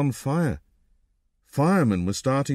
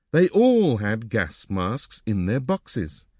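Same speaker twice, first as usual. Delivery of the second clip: ordinary delivery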